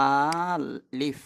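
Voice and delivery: আলিফ drawn out very slowly, long first aa; letter's name sounded out. Shown as a demonstration. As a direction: stretch the আ of আলিফ, then place লিফ separately. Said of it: "এটা হলো তার নাম".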